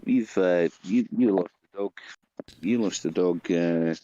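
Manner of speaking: normal talking voice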